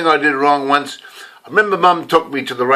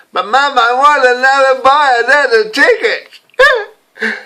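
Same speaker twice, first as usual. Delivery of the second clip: put-on high, slow pleading child's whine; whiny child; 11 semitones higher, 5 dB louder, slower than usual. Young [name] whining to Mum for another ticket. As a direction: beg like a little boy refused more money. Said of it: "himself as a child begging".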